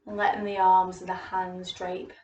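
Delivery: soothing yoga teacher voice; guided yoga cueing. Acted. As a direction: lead the class gently through the stance. Leads a gentle yoga practice.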